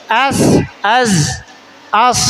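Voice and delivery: as, az, as with the consonant held at the end; sukun letter demo. Shown as a demonstration. Teacher demonstrates letters with sukun for pupils. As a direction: close each syllable on its consonant. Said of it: "Atau disukunkan".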